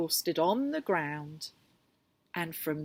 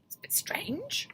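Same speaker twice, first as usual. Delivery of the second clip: whispered aside; 13 semitones higher, 5 dB quieter than usual. Real, not acted. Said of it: "reader aside".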